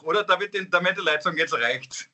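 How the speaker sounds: normal talking voice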